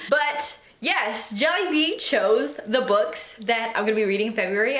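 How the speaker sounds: usual speaking voice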